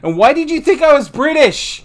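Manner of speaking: high, loud demanding yell. Acted. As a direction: shout the question. Repeated question, now yelled.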